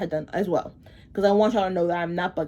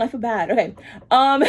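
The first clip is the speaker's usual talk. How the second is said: ordinary talk, nothing marked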